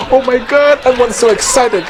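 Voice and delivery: high, excited crowd gasp. Retold or imitated. Guests at the wedding, a high excited gasp. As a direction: gush along with the guests. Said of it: "everyone's like".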